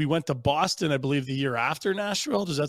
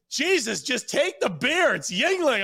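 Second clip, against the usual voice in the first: high-pitched imitation of a fed-up friend; mock exasperation, drawn out and high; exasperated; 11 semitones higher, 3 dB louder, slower than usual. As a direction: act out losing patience with a confused pal. Retelling friend's exasperated outburst.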